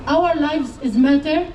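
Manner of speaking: ordinary delivery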